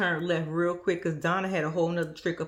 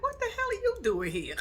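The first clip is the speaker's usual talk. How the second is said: higher startled son voice; mimicking son; 13 semitones higher than usual. Vlogger imitating Louis finding his mom there, higher startled voice. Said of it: "He's like".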